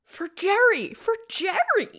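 high-pitched, drawn-out disbelief; incredulous. Real, not acted. Disbelieving that anyone would choose Jerry, incredulous. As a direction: repeat it in rising disbelief, stretching the name.

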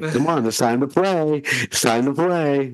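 high playful dog voice; dog's voice. Retold or imitated. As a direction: voice the eager dog, slowly.

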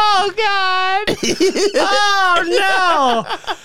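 high wail breaking into laughter; half crying with laughter; appalled laughing wail. Real, not acted. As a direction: moan it high while cracking up. Helpless laughing reaction to picture.

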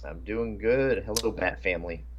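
usual speaking voice

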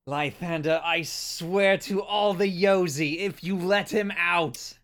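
slow, high strained threat; straining over wind. Acted. Gavel warning Lythanda over turbulent winds, slow high call. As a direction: stretch each word to carry over the gale. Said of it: "You just hear Gavel gr-, just over the turbulent winds".

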